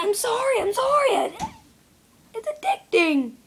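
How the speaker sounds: high, sobbing sorry; crying apology. Acted. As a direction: beg through tears. Guilty toy confession.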